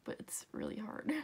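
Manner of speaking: whispered admission to herself; soft unvoiced aside. Real, not acted. Aside about fingers covering work.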